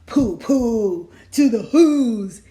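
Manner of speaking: the Grinch taunting the Whos from Mount Crumpit; gloating taunt. Acted. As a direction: jeer down at the town.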